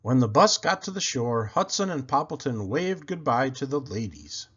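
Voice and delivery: ordinary delivery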